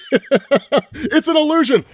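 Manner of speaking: laughing, slow and high. Real, not acted. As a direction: laugh through the reveal. Reveals the twist laughing.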